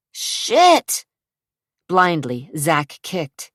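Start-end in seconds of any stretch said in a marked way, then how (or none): 0.1-1.0 high, loud curse of pain. Acted. Wounded Zach curses in pain, high loud.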